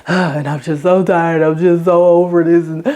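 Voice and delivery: mild exhausted moan to herself; a touch of giving up; weary sigh. Retold or imitated.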